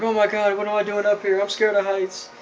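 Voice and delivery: high-pitched mock panic; whiny, acted-out fear held high; retold freak-out. Tristan coming to, high up the building, mock freaking out. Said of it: "he freaks out".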